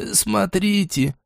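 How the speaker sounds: high, hushed, slow frightened call; frightened cry. Acted. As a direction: gasp it out in fear. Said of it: "с ужасом произнесла".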